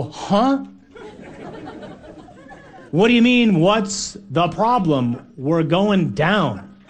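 incredulous, rising then slow; hammed-up disciple who can't believe it; mock disbelief. Disciples protesting to Jesus, mock disbelief. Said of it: "And we go".

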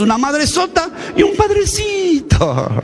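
mock shout. Mocking religious imbalance.

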